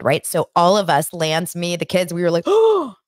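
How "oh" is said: gasping shocked cry of oh; a sudden high gasp of shock. Retold or imitated. Kids gasp at the TV twist.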